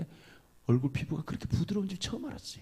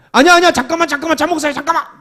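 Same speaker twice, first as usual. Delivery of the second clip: higher and louder, hurried call; urgent pleading; 10 semitones higher, 17 dB louder, faster than usual. Retold or imitated. Father on the phone begging his son to wait, urgent and loud. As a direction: rush to stop him hanging up.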